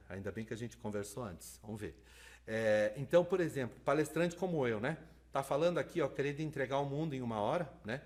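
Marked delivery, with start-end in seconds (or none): none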